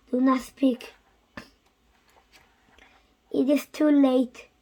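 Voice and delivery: ordinary delivery, nothing marked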